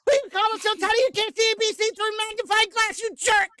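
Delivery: fast, high shouty anime hero; Edward Elric voice. Acted. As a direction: rant like an offended short anime hero. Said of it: "Edward Elric".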